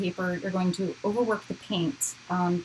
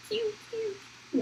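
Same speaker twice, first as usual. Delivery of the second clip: high pew pew laser sounds; Star Wars blaster noises; 5 dB quieter than usual. Pretend. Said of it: "now I'm making Star Wars noises".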